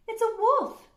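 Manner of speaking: high loud frightened cry; frightened shriek. Acted. Red spotting the wolf, shrieking in fright. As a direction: yelp it out in fright. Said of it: "said Red".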